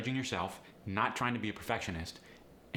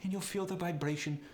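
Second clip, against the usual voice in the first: higher-pitched Polish accent, forward in the mouth; 7 semitones higher than usual. Shown as a demonstration. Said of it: "How about the Polish resonance?".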